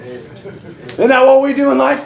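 ordinary talk, nothing marked

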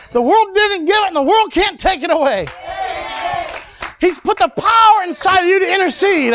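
high-pitched, insistent pulpit exhortation; impassioned preaching. Real, not acted. Preacher telling believers God can speak through them, impassioned preaching. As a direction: drive the point home to the congregation. Preaches the lesson at full force.

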